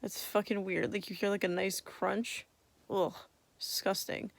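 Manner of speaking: normal talking voice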